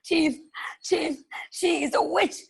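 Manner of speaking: high, urgent breathless telling; panicked report. Acted. Second robber reports the witch to his chief in fright. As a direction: rush it out to the chief, scared. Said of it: "ran as fast as he could".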